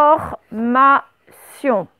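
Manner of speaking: information stretched out slowly; slow dictation voice. Shown as a demonstration. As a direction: pronounce information very slowly, syllable by syllable. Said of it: "On fait bien quatre syllabes".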